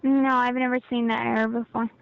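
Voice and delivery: normal talking voice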